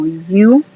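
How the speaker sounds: U said as "yoo", drawn out and rising; U with a y-glide in front, "yoo"; long U. Shown as a demonstration. Teacher shows a sound of English U, U as "yoo". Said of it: "que a veces suena como".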